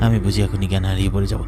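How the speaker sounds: dizzy, fading. Acted. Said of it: "মাথা ঘুরছে আমার".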